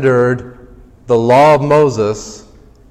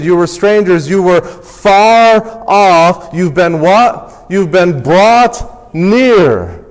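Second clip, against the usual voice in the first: higher, louder, slow and drawn-out sermon build; full-force preaching; 6 semitones higher, 4 dB louder than usual. Acted. Pastor preaching to the congregation, full force.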